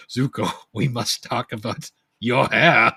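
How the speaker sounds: loud, slow, over-dramatic proclamation; hammy stage uncle. Retold or imitated. Host mimics the play's Uncle Iroh, hammily grave. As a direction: declaim it slowly, rising toward amusement. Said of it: "Uncle Iroh in the play is like".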